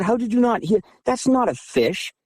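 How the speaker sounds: high-pitched indignant disbelief, acted out; retold arguing. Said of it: "And we're arguing".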